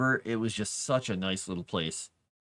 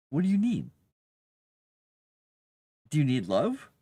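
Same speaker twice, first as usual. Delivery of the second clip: pet talk; 6 semitones higher, 4 dB louder than usual. Real, not acted. Cooing at the cat.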